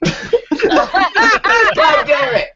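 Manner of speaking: high, drawn-out curse through laughter; laughing exclamation. Real, not acted. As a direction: curse while cracking up. Cracking up at the bit.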